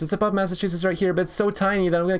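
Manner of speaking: fast, high-pitched indignant rant; indignant protest. Real, not acted. Gamer arguing with a map quiz, indignant protest. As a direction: rattle off your complaint, exasperated. Protests the state is too small.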